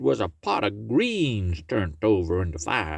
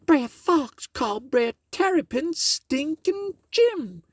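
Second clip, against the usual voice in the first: pitched way up as Miss Meadows; a high exclaiming lady. Acted. Miss Meadows answering Brer Tarrypin, high and exclaiming.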